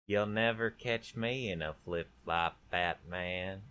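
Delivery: slow, creepy villain voice; creepy, measured menace with a Southern lilt; Joker. Shown as a demonstration. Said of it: "the voice I do for everything creepy".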